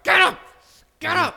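shouting a dozing colleague awake; yelled get up. Retold or imitated. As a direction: bark him awake under the table. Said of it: "I'm like".

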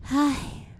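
high soft sigh "Ay"; a weary sigh. Acted. Girl sighs.

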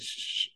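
soft unvoiced shushing hiss. [name] hushing Mike mid-sentence with a soft shush. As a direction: hush him quietly. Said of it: "stops Mike from talking".

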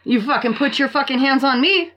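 cackling co-host plots a hot-grits payback, fired up; fired-up rant. Real, not acted. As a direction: get hyped up, egging on revenge. Fired-up rant about revenge.

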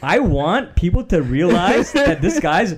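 play-fight with your co-host, loud; mock angry rant.

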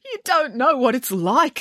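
high, self-pitying whine; sniffy, tearful. A narrator's reading. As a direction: complain as if still weepy. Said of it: "sniffed the hairdresser".

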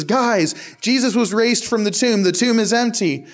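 women bursting in with amazing news; excited news. Retold or imitated. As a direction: blurt it out like you just ran from the tomb. Marys running back from the tomb.

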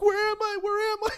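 high, loud frantic cry; panicked. Retold or imitated. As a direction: call out lost and scared. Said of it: "she was like".

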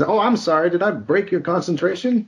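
high sarcastic movie-line voice; Pulp Fiction quote. Retold or imitated. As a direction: fake sorry with a threat under it. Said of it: "it was a line from".

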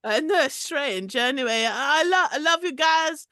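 lightly put-on French accent; a hint of a Parisian caller; mild mock French accent. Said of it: "dialing code of my accent".

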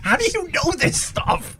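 high, laughing amazement; laughing disbelief. Real, not acted. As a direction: ask it through real laughter. Host cracks up at guest's acronym.

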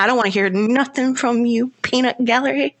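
ordinary delivery

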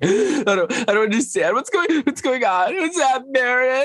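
gasping, very high panicky imitation; mock panic attack. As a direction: parody her breathless meltdown in a squeaky-high voice. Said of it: "like, a panic attack".

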